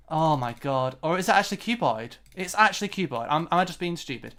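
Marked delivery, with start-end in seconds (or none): none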